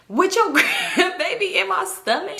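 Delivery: high, stretched-out mock outrage; indignant retort. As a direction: draw it out high, imitating an affronted woman. Repeats the indignant quarrel line.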